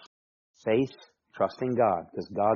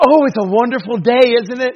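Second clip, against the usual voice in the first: very high, very loud mock gushing; hammed-up euphoric small talk; over-medicated cheery cashier; 13 semitones higher, 14 dB louder than usual. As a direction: gush like a manically happy cashier. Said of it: "antidepressant stuff is starting to freak me out".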